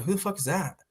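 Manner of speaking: puzzled question kept to yourself; under the breath. Retold or imitated. Viewer wonders about the unknown heckler, under his breath. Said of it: "just in, under my breath".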